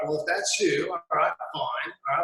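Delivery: ordinary talk, nothing marked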